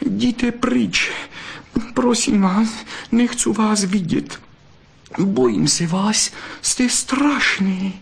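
very high vixen voice, distressed; frightened pleading. A narrator's reading. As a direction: plead with him to go away. Vixen begs fox to leave. (ustrašeně a prosebně, vysokým hlasem)

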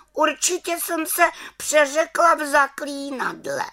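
very high little-witch voice. A narrator's reading. Little witch explaining her botched spell, high voice. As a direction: excuse yourself in a high small-witch voice. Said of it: "pravila malá čarodějnice".

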